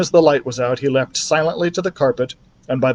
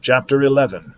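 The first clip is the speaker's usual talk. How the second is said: ordinary delivery, nothing marked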